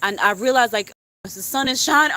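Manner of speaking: normal talking voice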